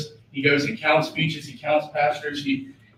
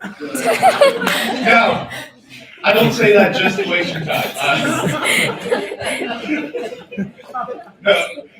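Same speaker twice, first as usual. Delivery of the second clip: breaking up into laughter, higher and louder; laughing at own joke; 9 semitones higher, 5 dB louder, much slower than usual. Real, not acted. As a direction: crack up at the punchline you just landed. Laughs at his own punchline.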